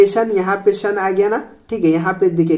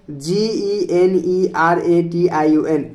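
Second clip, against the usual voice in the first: slow, letter by letter, each letter apart; spelling out; much slower than usual. Shown as a demonstration. Teacher spells education for students, slow letter by letter. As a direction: spell it out slowly for the class. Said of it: "spelling the word out".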